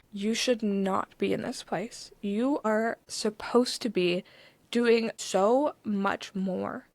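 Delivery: slow, deliberate, word by word; retold stranger pressing the point slowly; earnest urging. Stranger earnestly urges her, word by word.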